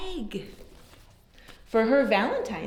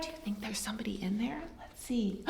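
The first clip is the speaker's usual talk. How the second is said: fast hushed whisper; suspenseful whisper; 3 semitones lower, 13 dB quieter, much faster than usual. Real, not acted. Teacher asking children in suspense, fast whisper. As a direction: lean in and whisper. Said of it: "whispered suspense question".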